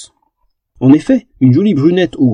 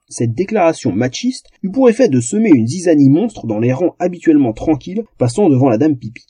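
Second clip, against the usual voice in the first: normal talking voice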